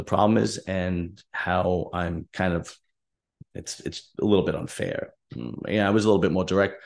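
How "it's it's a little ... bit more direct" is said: ordinary delivery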